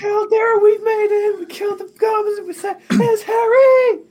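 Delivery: very high, loud squealing report; high-pitched overexcited character voice; ecstatic reunion shouting. Acted. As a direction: shriek the good news, building to a long yell. Player character breathlessly shares news, reunion.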